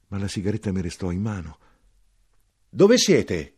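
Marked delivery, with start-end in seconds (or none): 2.7-3.6 high, loud call into the dark. Acted. Narrator calling for the vanished soldier, loud. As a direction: call out to someone you can't see.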